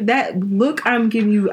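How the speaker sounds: ordinary talk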